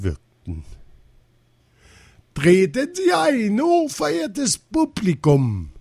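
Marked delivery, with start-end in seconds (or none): 2.3-5.7 high, loud ringmaster's proclamation; circus director calling out. A narrator's reading. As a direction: call in the crowd with grand flourish. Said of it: "rief Rinaldo".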